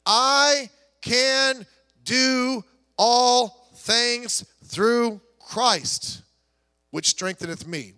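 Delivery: drawn out word by word, loud at the start; slow solemn verse reading. Acted. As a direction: read it slowly, like scripture you savour. Preacher reading the key verse.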